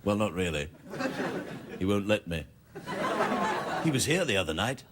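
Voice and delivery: monkey king puppet, low deadpan asides; low, softened accented confiding; Kronos. Acted. Monkey king lets the audience in on it, low deadpan.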